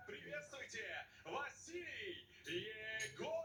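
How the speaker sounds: slow, drawn-out ring introduction; boxing ring announcer. Real, not acted. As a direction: announce the fighter to the arena. (объявление ринг-анонсера, растянуто)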